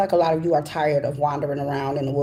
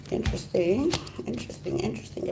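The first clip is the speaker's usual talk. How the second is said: softer each time, down to a whisper; fading to a whisper; 4 semitones higher, 8 dB quieter than usual. Real, not acted. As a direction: murmur it, trailing off into breath. Mulling over the cards, fading.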